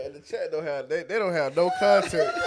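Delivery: loud, helpless cracking up; real laughing. Real, not acted. Co-host cracking up that chat has no context, laughing. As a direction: push the words out through big laughs.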